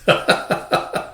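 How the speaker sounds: short unvoiced chuckle; quick breathy run of laughter. Real, not acted. Laughs after reading the article.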